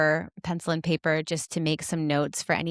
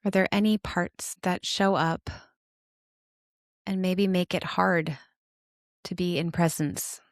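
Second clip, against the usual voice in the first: guided meditation; 3 semitones lower than usual. Acted. Host inviting listeners to notice their parts, guided meditation. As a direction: guide them inward, unhurried and calm, settling lower than chatty talk.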